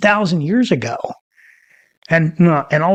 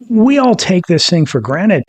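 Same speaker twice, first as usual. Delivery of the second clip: ordinary talk, nothing marked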